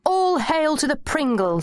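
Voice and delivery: high, slow ceremonial chant; mock acclamation. A narrator's reading. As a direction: hail it grandly, drawing out the words. Mock worship of the Pringle.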